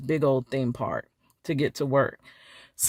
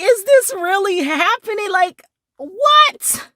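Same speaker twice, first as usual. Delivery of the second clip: mock disbelief; 13 semitones higher, 6 dB louder than usual. Her retold disbelief.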